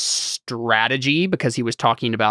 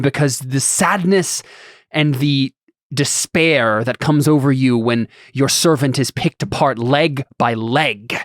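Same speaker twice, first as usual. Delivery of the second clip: grand villain monologue in measured phrases; lofty, dramatic; slower than usual. Acted. A would-be realm lord belittling an elf, lofty and dramatic. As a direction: declaim slowly, with heavy dramatic pauses. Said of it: "this is so dramatic and ridiculous".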